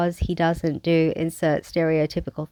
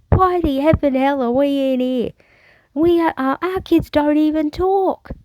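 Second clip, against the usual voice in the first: amateur imitation of a Samoan accent, pitched up; 8 semitones higher than usual. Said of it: "I can't even do an accent to save my life".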